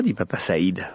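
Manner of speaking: usual speaking voice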